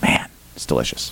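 a low, breathy whispered aside. Acted. Host closing the cider ad with a whispered aside. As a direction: whisper it like a secret treat.

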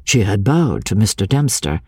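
ordinary delivery

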